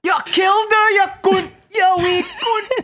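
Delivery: high, loud Scottish yelling; deep Scottish accent. Acted. Mocking actress slipping into Scottish, angry Scottish yell. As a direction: rant like a hammed-up furious Scot. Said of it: "slips into a deep Scottish accent".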